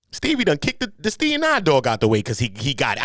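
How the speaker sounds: usual speaking voice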